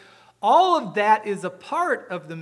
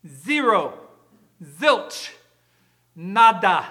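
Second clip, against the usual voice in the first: each word louder, hammered out; preacher's rising three-beat punch; emphatic punch; 8 dB louder, much slower than usual. Shown as a demonstration. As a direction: hit every word harder like a drumbeat. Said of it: "In our vernacular".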